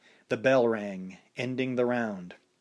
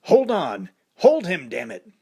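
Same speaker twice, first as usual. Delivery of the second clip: louder, higher angry yell, dropping on damn it; cursing; 7 semitones higher, 7 dB louder than usual. A narrator's reading. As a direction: bark it at the fighter in frustration. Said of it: "Apollo cursed".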